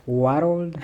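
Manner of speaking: mock her thick accent, "World" in two syllables; "World" stretched to WAR-old, amused imitation; accented pronunciation. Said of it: "She used to say world".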